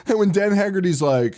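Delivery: normal talking voice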